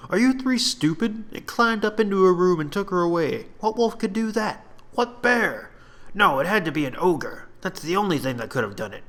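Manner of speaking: high, mocking voice, scornful of the others; scoffing soldier. Fourth soldier scoffing at the others, high and mocking. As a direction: sneer at them like they're idiots. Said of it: "A fourth soldier scoffed at the rest".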